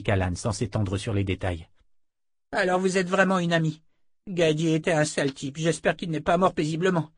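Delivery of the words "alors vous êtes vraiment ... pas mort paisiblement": put-on high young voice, relieved; a teenage boy, voiced higher; boy's voice. A narrator's reading. Boy learning Gadi died, high and relieved.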